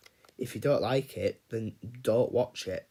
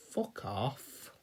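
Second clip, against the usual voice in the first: muttered dismissal; 3 semitones lower, 9 dB quieter than usual. Real, not acted. Dismissing film buffs who shame him.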